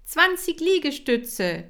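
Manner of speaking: high, loud, slow trainer call; called command. Acted. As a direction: call out to the class. Kira ruft Übungen.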